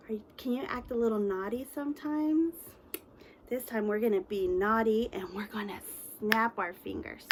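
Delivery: normal talking voice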